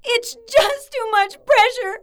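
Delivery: crying. Acted. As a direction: break down crying over the pressure. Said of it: "are those tears".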